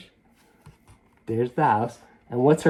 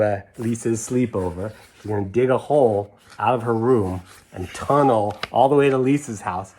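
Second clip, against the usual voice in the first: ordinary delivery, nothing marked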